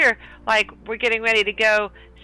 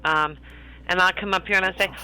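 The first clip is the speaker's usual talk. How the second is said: normal talking voice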